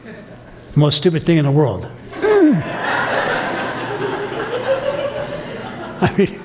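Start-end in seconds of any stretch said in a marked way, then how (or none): 2.1-6.0 long high burst of laughter; real high laughing fit. Real, not acted. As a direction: laugh hard at the silliness. Big laugh at himself.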